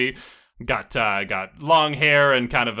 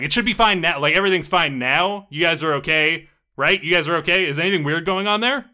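higher, louder worried questioning; anxious checking; 5 semitones higher, 5 dB louder than usual. Acted. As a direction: check nervously that everyone is okay. Butcher checking they are safe.

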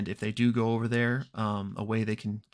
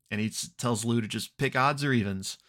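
normal talking voice

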